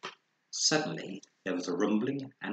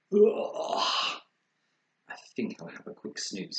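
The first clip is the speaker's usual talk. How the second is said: tired groan; 2 semitones higher than usual. Acted. Very tired bear.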